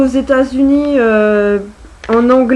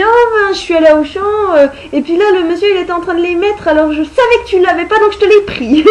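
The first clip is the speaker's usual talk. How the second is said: higher, quicker voice for mom; imitating mom; 6 semitones higher, faster than usual. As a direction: mimic mom's excited chatter, fast. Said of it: "Alors, elle me dit". (imitation de maman, voix plus aiguë et rapide)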